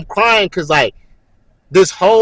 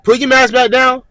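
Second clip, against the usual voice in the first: instructor's order; 8 semitones higher, 4 dB louder than usual. Retold or imitated. Acted instructor ordering recruit.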